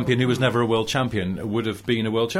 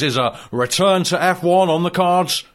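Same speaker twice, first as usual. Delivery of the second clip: normal talking voice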